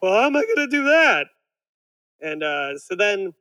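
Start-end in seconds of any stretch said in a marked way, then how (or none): 0.0-1.3 higher, louder and quick, a hammed-up Homer. Homer baffled at the planner, in a hammed-up higher whine. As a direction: whine it out fast like a bewildered Homer. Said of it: "I'm like".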